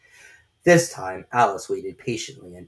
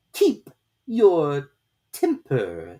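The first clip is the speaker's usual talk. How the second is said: very slow, loud, strange drawn-out voice; slow caterpillar drawl; 6 semitones higher, 5 dB louder, much slower than usual. A narrator's reading. Caterpillar lazily questioning Alice, very slow and loud. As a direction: stretch every word lazily, from on high.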